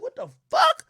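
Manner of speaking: shocked high squeal of disbelief; incredulous. Retold or imitated. Retelling his reaction to her show, a shocked high squeal. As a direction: react as if you can't believe your eyes. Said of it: "I'm like".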